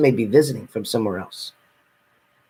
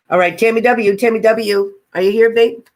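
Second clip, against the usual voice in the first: higher and louder, hailing someone in the chat; calling out; 9 semitones higher, 4 dB louder than usual. Real, not acted. Reader calling out to find viewer Tammy, loud and high. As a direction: call her name out to find her.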